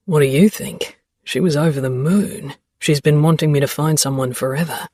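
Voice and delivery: a hint of a teasing young man; Xavier voice. A narrator's reading.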